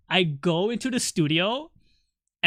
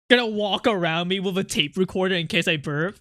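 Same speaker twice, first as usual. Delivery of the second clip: usual speaking voice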